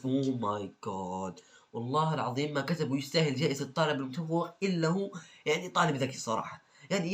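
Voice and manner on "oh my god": exasperated sigh. Real, not acted. Exasperated reaction.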